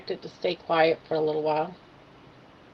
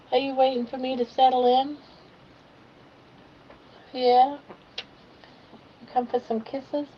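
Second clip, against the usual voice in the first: higher, louder sing-song to a pet; pet talk; 4 semitones higher, 5 dB louder than usual. Real, not acted. Talking to her pet.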